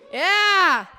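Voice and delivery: loud, high whooped cheer; cheering for applause. Pretend. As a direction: whoop it to get a crowd clapping. Cheering to prompt crowd applause.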